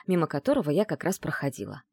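ordinary delivery

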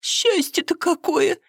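put-on tearful happy cry of the old woman; tearful joy. A narrator's reading. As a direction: weep it out with joy. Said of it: "всхлипнула".

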